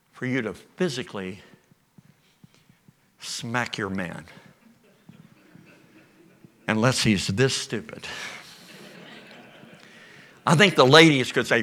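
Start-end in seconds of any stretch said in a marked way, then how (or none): none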